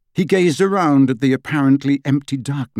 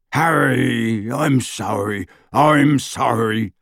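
moaning apology; much slower than usual. A narrator's reading. Hagrid apologising to Harry for the sidecar, slow moan. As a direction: drag out the apology in misery. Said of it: "moaned Hagrid".

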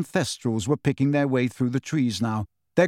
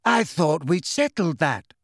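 maddeningly calm; 3 semitones higher than usual. A narrator's reading. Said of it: "said Luna maddeningly".

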